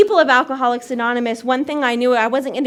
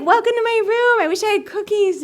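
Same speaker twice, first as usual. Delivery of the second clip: high-pitched perky welcome; sing-song campus guide greeting; orientation leader; 8 semitones higher than usual. Retold or imitated. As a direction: play the oblivious, eager hostess. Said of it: "I was an orientation leader".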